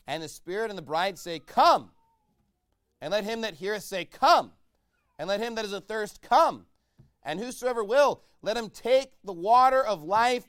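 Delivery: loud, high preaching with shouted calls of come; full-force preaching. Acted. Preacher calling the church to come, loud and high. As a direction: cry it out to the whole congregation.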